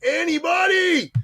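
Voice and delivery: high drawn-out hammed-up yell; mock shout. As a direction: belt it out like the overconfident client. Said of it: "And he goes".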